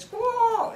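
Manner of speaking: loud high bird squawk; parrot squawk. Acted. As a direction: screech like a parrot. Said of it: "Mister McCall said".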